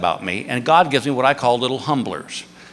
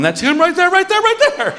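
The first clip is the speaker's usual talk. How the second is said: squealing much higher excited fan; shrieking high, louder fan voice; crazy fan; 4 dB louder than usual. Retold or imitated. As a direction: gush like a starstruck fan on tiptoe. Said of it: "the crazy fan on his tiptoes going".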